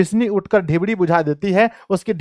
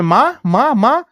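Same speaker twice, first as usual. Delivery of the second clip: frightened voice; 5 semitones higher, slower than usual. Acted. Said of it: "घबराए स्वर में".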